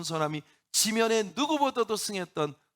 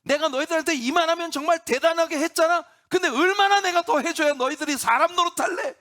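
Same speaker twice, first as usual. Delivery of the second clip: exasperated, higher and louder complaint; angry rant; 8 semitones higher, 5 dB louder than usual. Acted. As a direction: vent fed-up anger at the people. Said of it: "모세가 화가 나니까".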